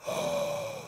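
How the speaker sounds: sharp high gasp of alarm; a storyteller's dramatic gasp. Acted. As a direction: gasp as if shocked by the news. Narrator reacts to mother gone.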